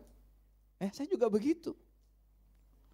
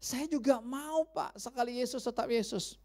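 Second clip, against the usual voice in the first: pleading believer; 6 semitones higher, 6 dB quieter than usual. Pretend. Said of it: "Mungkin kita berkata".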